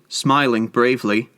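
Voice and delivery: ordinary delivery, nothing marked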